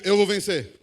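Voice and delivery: loud call for the congregation to echo; loud, at normal pitch and pace; declaration to repeat. Shown as a demonstration. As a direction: lead them in a firm, loud declaration. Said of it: "Então diga assim comigo".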